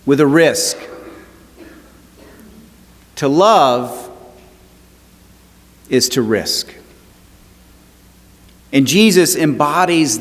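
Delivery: rising preacher's cadence with long pauses; a pastor turning a wedding story to Jesus, preaching with building fervour. Acted. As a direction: preach it, lifting the congregation.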